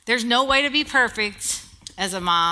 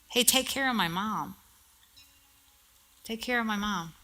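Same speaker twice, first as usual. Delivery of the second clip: much softer, gentle plea; tender hush; 9 dB quieter than usual. Retold or imitated. Preacher voicing Jesus to John from the cross, hushed and tender. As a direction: say it softly, as a dying son's request.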